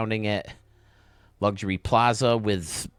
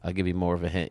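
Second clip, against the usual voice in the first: normal talking voice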